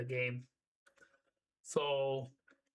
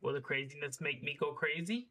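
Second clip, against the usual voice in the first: ordinary delivery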